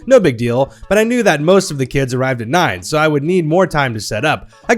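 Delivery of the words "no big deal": usual speaking voice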